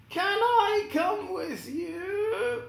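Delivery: high, slow, eager plea; Scarecrow asking. Acted. The Scarecrow begging Dorothy to take him along, high and slow. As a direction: beg to tag along. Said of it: "said the Scarecrow".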